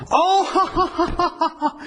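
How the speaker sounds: high mock laugh on "Oh"; the German lady gets the joke, high laugh; imitated laugh. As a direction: burst into a laugh of sudden understanding. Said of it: "she started to laugh".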